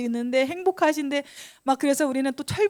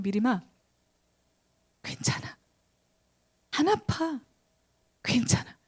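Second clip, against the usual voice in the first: whispered comfort; 5 dB quieter than usual. Retold or imitated. Friend comforting after being punished.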